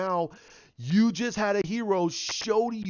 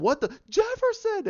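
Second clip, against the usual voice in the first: a shout that leaps much higher and louder on Jefferson; shocked shout; 4 dB louder than usual. Retold or imitated. Heroes crying out as Jefferson vanishes, shocked shout. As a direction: yell his name in sudden shock.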